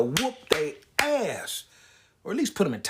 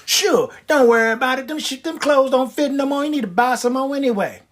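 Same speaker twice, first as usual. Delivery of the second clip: higher coddling granny tone; cooing granny voice; grandmama voice; 7 semitones higher than usual. Acted. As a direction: reassure like a sweet old grandma. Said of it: "she sound like your grandmama".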